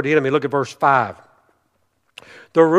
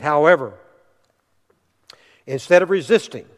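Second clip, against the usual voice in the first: ordinary delivery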